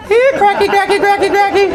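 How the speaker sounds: very high, loud sing-song call; high sing-song call to crackheads. Retold or imitated. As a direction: coax them out like calling a cat.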